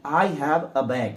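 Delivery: very slow, each word of I have a bag spaced out; slow model sentence. Shown as a demonstration. Teacher modelling the sentence slowly for learners. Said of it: "तो ए बैग".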